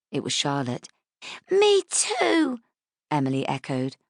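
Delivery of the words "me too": put-on high child's voice chiming in; a tiny girl copying her sister, higher; little girl echoing. Younger girl echoes her sister.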